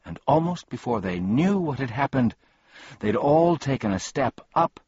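ordinary delivery

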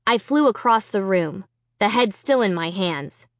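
usual speaking voice

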